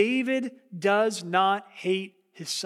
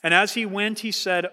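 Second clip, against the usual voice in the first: ordinary talk, nothing marked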